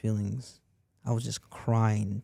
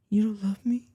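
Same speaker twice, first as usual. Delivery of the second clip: higher, louder pleading question; mock wounded girlfriend; 10 semitones higher, 5 dB louder than usual. Girlfriend accusing him of not loving her, mock wounded. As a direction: lightly imitate a hurt, guilt-tripping partner. Retold tearful guilt-tripping girlfriend.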